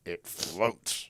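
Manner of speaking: slow, soft, creepy movie monster voice; It impression. Cohost suggesting another film quote, hushed It impression. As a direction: draw it out softly like a horror creature. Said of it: "gonna do, like, the It".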